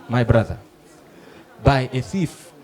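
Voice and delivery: normal talking voice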